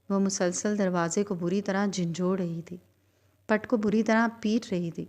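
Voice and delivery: ordinary delivery, nothing marked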